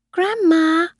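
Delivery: put-on high-pitched child's call. A narrator's reading. Child calls from the stairs.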